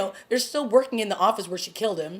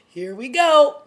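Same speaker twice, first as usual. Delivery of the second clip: higher and louder, here we go; excited; 6 semitones higher, 9 dB louder, slower than usual. Retold or imitated. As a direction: announce the trouble coming with glee. Anticipating drama in the show.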